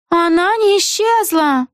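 high, slow character voice cutting in; Эгги Хуф interjecting. A narrator's reading. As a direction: butt in with a high, drawn-out voice. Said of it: "вмешалась Эгги Хуф".

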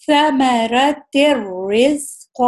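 ثمرت الرزق very slowly, tanween merged into the ra; slow recitation. Shown as a demonstration. Teacher demonstrating idgham into ra, slow. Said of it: "اس کی آواز نہیں ہم کریں گے".

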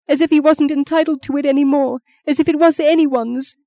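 high-pitched, grieving outpour; tearful distress. Acted. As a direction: pour out the awful news, distressed. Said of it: "Maggie heard her sob".

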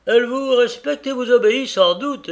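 high, loud, needling voice; sly Tackleton. A narrator's reading. Tackleton needling the carrier about his wife, high and loud. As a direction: prod the husband with mock respect.

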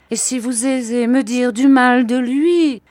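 high, loud indignant protest. A narrator's reading. Mother defends her dead husband to Miss Betsy, indignant. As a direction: raise the voice in offended defence.

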